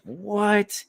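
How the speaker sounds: high, drawn-out incredulous "what"; shocked disbelief. Retold or imitated. Storyteller replaying his reaction to cousin's confession, shocked disbelief. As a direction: stretch it upward in mock disbelief.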